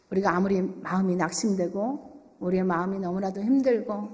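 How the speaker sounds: ordinary talk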